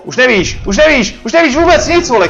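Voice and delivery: excited taunting. Real, not acted. Player mocking a desperate boss, pitch climbing excitedly. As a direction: jeer at it, pitch building.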